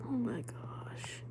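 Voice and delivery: slow, drawn-out whisper; shocked whisper. Real, not acted. Streamer taking in the aunt's story, slow stunned whisper. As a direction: whisper it slowly in disbelief. Shocked by what she reads.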